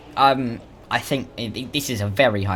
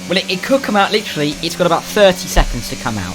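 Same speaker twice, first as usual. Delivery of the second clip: excited; 6 semitones higher, 6 dB louder than usual. Real, not acted. Live race excitement.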